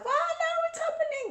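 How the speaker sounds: very high-pitched squeal of alarm; mock panic. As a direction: squeak out a comic, flustered panic. Retold panic at sudden post.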